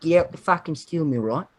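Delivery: thick mock Australian accent; Australian steelworker. Steel mill worker off to the pub, Australian accent. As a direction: play an Australian guy just off shift. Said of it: "it's like a Australian guy just got out of".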